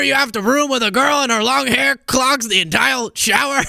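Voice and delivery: normal talking voice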